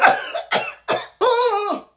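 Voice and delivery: high-pitched coughing and wheezing fit; hacking cough. Acted. Sick boy asking to play, coughing badly. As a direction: cough till out of breath.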